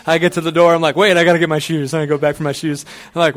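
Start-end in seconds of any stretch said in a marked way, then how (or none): 1.0-1.9 loud, mock-urgent self-interruption; sudden remembering. Dog owner at the door needs his shoes, loud sudden remembering. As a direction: stop yourself at the door, voice raised. Acts out himself stalling at door.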